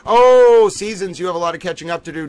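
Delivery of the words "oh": sudden loud exclaim. Real, not acted. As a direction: burst out with a loud oh.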